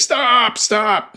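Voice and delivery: high, loud yell to stop the cab; panicked call to halt the car; urgent shout. Retold or imitated. Gary yelling at cab to stop.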